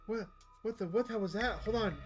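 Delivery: startled disbelief. Real, not acted. Player startled by game event.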